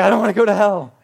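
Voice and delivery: loud, hammed-up childish whine; mock scared kid. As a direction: play a frightened camper pleading. Acting scared twelve-year-old camper answering.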